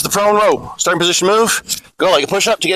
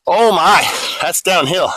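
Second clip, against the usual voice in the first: ordinary delivery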